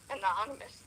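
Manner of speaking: ordinary talk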